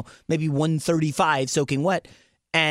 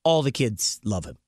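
usual speaking voice

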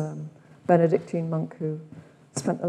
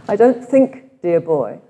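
louder cut-glass Oxford English, quoted; 9 dB louder, slower than usual. Retold or imitated. Retreat leader repeats Bede's line in Oxford English. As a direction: do Bede's clipped-vowel Oxford English again, with relish. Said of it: "He had quite a cut glass Oxford English accent".

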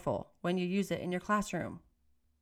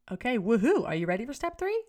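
excited cheer; 4 semitones higher, 4 dB louder than usual. Acted. Hyping up step three.

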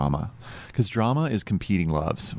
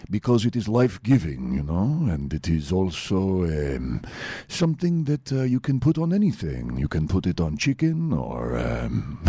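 Dracula voice; slower than usual. Pretend. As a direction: play a slow, creepy Count Dracula.

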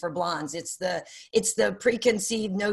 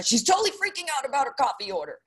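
mock gossip voice; 6 semitones higher, faster than usual. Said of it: "was read as a".

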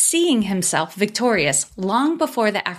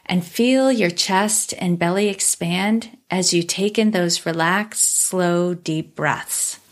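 calm meditative tone; 3 semitones lower than usual. Acted. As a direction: ease them into stillness, unhurried. Leads a guided body scan.

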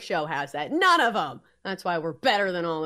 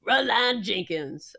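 character voice; slower than usual. Acted. Voices missed character's name.

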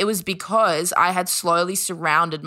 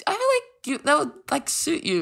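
very high, tentative put-on voice; imitated sister; faster than usual. Sister explaining her dating suggestion, high tentative imitation. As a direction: float the idea lightly, high and hedging.

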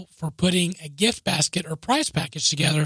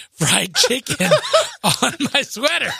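laughing through the words; 6 semitones higher, 3 dB louder than usual. Real, not acted. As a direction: burst into laughter mid-sentence. Laughing at his own mishap.

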